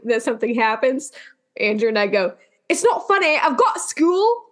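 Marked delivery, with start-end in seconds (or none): 2.7-4.4 hammed-up imitation of the TikTok accent; mock accent from a video. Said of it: "we can't do the accent right".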